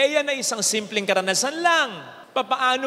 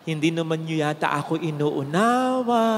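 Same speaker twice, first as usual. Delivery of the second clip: hurt, gentle plea; 8 semitones lower than usual. Retold or imitated. Priest showing the crowd his hurt tone, lower. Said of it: "Ganun ang tono".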